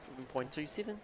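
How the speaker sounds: put-on New Zealand accent, clipped vowels; mock Kiwi accent. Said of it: "you can't really say eleven with a Kiwi accent".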